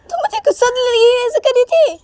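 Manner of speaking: very high, loud accusing cry; outraged Uma. Acted. Uma accusing Sanju of provoking her, high outraged wail. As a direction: shriek the accusation.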